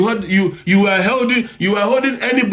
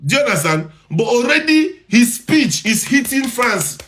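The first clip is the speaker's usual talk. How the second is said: ordinary delivery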